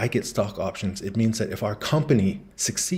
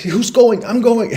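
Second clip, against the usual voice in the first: much higher, louder eager shouting; frantic husband; 12 semitones higher, 6 dB louder than usual. Retold or imitated. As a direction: jump in, volunteering desperately.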